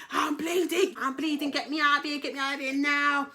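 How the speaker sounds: high, loud panicked yell; mock panicked screaming for help; retold screaming. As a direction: scream it like you're bleeding at the door. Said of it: "like, screaming".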